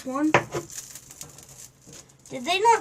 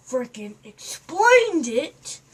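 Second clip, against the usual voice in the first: normal talking voice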